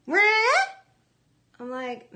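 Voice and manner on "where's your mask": high and loud, words muffled into where ya at; mask lady imitation. A grocery store shopper asks about her mask, high muffled call. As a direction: mimic the garbled question from behind a mask. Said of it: "She was trying to say".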